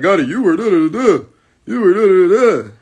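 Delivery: lightly voiced whiny critic, mocking.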